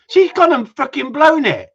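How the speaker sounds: high, loud outburst at a blown punchline; exasperated shouting. Real, not acted. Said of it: "I don't know why I'm shouting".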